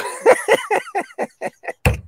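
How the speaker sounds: shrill squealing laugh at his own joke; high-pitched giggle. Real, not acted.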